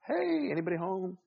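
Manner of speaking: high, put-on "anybody there" call; mock calling out. Customer to blank worker at the window, high mock call. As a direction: check for signs of life, pitched high.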